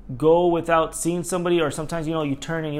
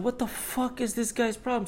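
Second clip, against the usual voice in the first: high, breathy-free incredulous mutter in a raised pitch; baffled outrage; 9 semitones higher, 5 dB quieter than usual. Retold or imitated. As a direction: voice the disbelief at the rude driver, pitched up and held back. Annoyed driver quote in traffic.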